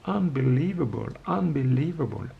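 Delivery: a lightly put-on American English accent, slow and drawn out; American commentator accent. Imitating American commentators marvelling at the three-point streak, mock American accent. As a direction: mimic an American TV commentator, stretching each unbelievable. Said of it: "avec les commentateurs qui font".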